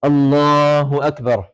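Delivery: very long "Allahu", then "Akbar" falling; drawn-out takbir. Shown as a demonstration. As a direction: hold the vowels of "Allahu" while rising from prostration. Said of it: "like this".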